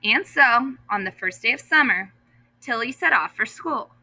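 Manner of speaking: ordinary delivery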